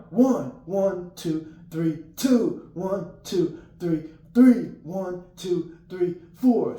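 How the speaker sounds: loud, high cadence count; yelled count. Retold or imitated. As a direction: bark out each number during flutter kicks. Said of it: "and you yell one".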